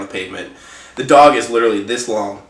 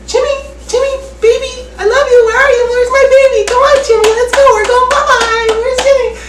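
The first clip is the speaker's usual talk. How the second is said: much higher, louder sing-song dog owner call; 4 dB louder than usual. Retold or imitated. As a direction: coo for a lost puppy, high and loud. Owner calling for her dog.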